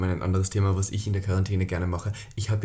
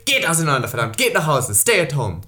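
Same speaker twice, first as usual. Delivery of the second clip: loud, raised mock command; shouting down; 3 semitones higher, 5 dB louder than usual. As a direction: holler orders from the fourth floor. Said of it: "schreie ich immer so". (gespielt wütendes Herunterschreien)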